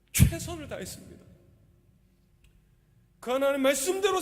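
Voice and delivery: high, loud impassioned preaching; full-force preaching. Acted. Pastor to congregation, how the poet held on, full-force preaching. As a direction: proclaim it with fire to the congregation.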